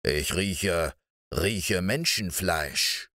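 low, slow, sniffing giant voice; a menacing ogre slowly smelling out prey; eerie giant. Acted. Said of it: "sagte er unheimlich". (unheimliche, tiefe Riesenstimme)

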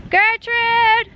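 loud, high call across the field; loud high-pitched call to a missing animal. Real, not acted. Calling animals across the farm.

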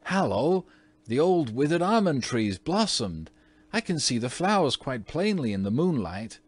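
put-on high, loud excited boy. A narrator's reading. Twin at the window calling out excitedly. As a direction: blurt out the wonder at the window.

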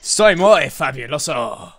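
mock Spanish accent. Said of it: "Should I set this video as Spanish".

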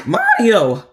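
loud, high worried cries; alarmed calling out. Acted. Luigi checking on Mario, alarmed and loud. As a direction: call out sharply in rising alarm.

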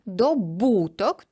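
drawn-out дОбуток; stress on до. Shown as a demonstration. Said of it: "тому що він добув". (повільно, наголос дОбуток)

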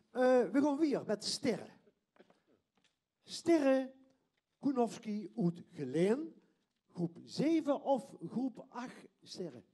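loud host announcement; announcer voice. Real, not acted. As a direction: announce the next act. Host announcing next performer.

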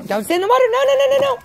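high, loud alarmed no, no, no; alarmed call. Real, not acted. Parent warning toddler off the water, loud alarm. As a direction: stop her fast, calling out high.

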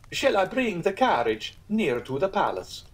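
high, eager guide; lightly voiced fawning guide; cicerone voice. Cicerone calls.

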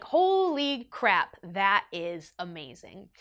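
drawn-out awe, each word stretched; slow amazement. Retold or imitated. Rider hearing the widower's new life, slowly amazed. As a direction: stretch it out, deeply impressed. Awed by driver's life story.

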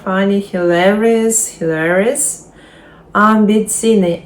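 ordinary talk

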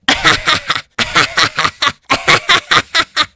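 high, loud giggling cackle; hammed-up high kid laugh; Goten laugh impression. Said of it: "I can really only do the laugh".

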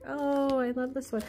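ordinary talk, nothing marked

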